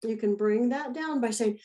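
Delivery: ordinary talk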